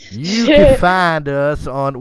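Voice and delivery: slow, loud mock announcer. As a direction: stretch it out like a big announcer.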